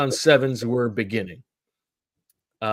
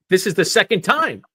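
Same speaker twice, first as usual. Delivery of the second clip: ordinary talk, nothing marked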